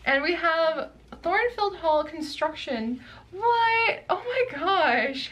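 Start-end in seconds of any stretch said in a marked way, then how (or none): 3.3-5.3 high, loud gasp of disbelief, then a slow stunned oh my gosh; real stunned delight, high and loud; real surprise. Real, not acted. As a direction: shriek it, caught off guard by the extra. Surprise at bonus fold-out.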